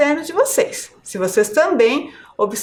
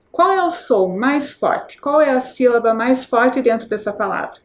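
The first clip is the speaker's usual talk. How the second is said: ordinary delivery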